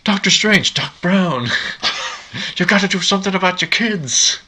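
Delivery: high, frantic Doc Brown. Retold or imitated. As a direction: squeal it like a panicked old scientist. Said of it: "he's like".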